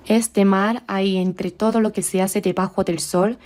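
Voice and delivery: ordinary talk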